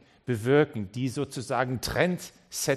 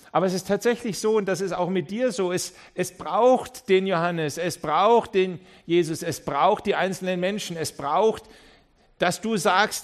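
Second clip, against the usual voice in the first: higher and louder, hammering each "es braucht"; fervent preaching; 5 semitones higher, 6 dB louder than usual. Acted. Preacher telling each listener they are needed, fervent preaching. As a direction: pound every line at the crowd.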